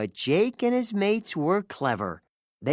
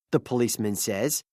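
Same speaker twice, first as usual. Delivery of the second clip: plain reading voice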